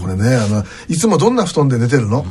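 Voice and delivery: playing a sly salesman who flatters a grandpa; smooth salesman to grandpa. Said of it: "とかって言いながら".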